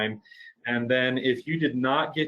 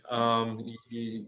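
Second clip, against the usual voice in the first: normal talking voice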